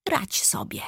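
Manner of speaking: unvoiced whisper; soundless mouthing. A narrator's reading. Vampire shrugs and leaves the narrator to cope, whispered. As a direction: whisper it, barely any sound. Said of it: "powiedział bezgłośnie".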